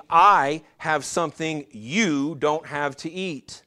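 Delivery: slow line with "I" and "you" each held and set apart; emphatic I and you. Shown as a demonstration. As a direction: stress on I and YOU, drawn out slowly. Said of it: "the I and the you ... are emphatic".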